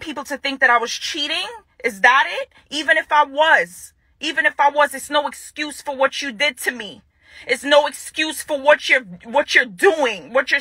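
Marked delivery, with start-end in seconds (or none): none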